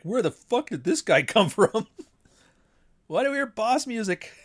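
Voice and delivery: mock astonishment. Reading astonished commenters, laughing.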